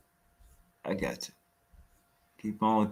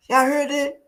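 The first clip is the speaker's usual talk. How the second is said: much higher, louder call to the crowd; rally the viewers, incredulous; host calling out to his viewers, worked up; excited call-out; 13 semitones higher, 5 dB louder than usual. Real, not acted. Calls out to his audience.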